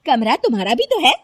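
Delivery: loud, high, scolding; indignant marital squabble; angry quarrelling wife. Acted. Daughter-in-law quarrels with her husband.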